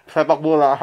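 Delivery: normal talking voice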